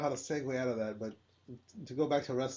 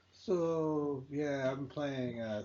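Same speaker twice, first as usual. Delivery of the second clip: slow, softer, bored game commentary; monotone commentator; 3 semitones lower, 4 dB quieter, much slower than usual. Retold or imitated. Podcaster imitating a monotone video commentator, dragging slowly. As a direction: drone it out like a dull gamer. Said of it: "You got a monotone voice?".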